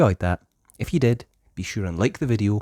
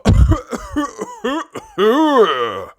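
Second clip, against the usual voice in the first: high cough; 6 dB louder than usual. Acted. Doctor catches cough.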